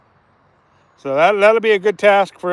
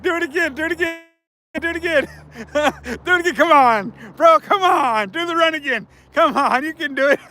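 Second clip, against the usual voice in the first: excited cheering; 7 semitones higher than usual. Real, not acted. Egging buddy on to repeat run.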